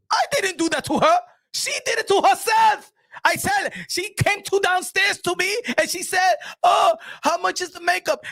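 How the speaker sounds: indignant makeup artist yelling; irate makeup artist. Retold or imitated. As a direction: rant as the offended makeup artist. High shouting makeup artist.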